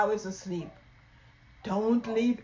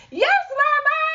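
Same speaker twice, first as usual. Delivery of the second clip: put-on loud, high child's answer; very loudly; 10 dB louder than usual. Loud Mouse answering mama very loudly. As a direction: shout the reply. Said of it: "very loudly".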